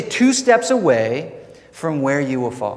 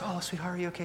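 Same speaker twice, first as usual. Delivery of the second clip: soft, higher, much quieter motherly voice; tender mother; 5 semitones higher, 16 dB quieter, much faster than usual. Retold or imitated. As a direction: hush it like a mom soothing her child. Mother comforting fallen child.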